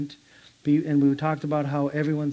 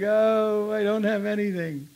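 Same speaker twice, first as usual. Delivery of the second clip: imitating crying; 6 semitones higher than usual. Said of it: "So he was crying".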